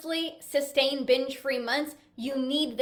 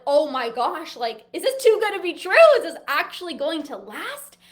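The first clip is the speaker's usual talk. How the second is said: mock freak-out; 3 semitones higher, 7 dB louder than usual. Coach voicing a client who doubts lasting success, mock freaking out. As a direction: act out a client's freak-out, peaking on "true". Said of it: "instead of freaking out like".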